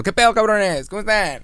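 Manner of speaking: high, punchy show greeting; exaggerated high-pitched intro; podcast opener. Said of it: "Porque empiezo diciendo".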